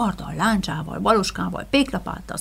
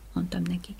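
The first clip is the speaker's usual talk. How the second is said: plain reading voice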